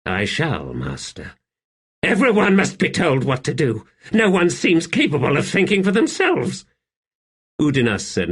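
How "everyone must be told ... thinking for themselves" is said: high, querulous emperor's voice; high-pitched imperious grumbling; exasperated emperor. Acted. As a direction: complain about everyone beneath you. Narrator voices the emperor complaining.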